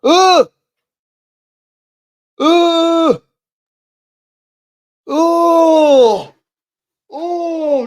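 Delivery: high, loud shocked cries of Oh; shocked shouts. Real, not acted. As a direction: recoil and yell at the gruesome injury. Gruesome finger injury on screen.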